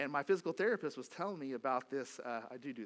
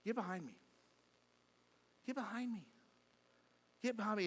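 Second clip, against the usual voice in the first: put-on dad calling kids; 5 semitones higher, 4 dB quieter than usual. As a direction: call the kids back, spaced out and restrained. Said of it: "I just yelled out and said".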